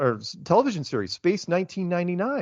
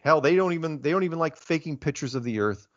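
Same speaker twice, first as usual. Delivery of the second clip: ordinary talk, nothing marked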